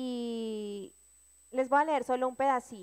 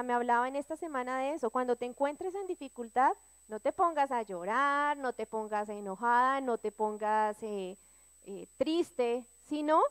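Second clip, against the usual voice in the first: ordinary delivery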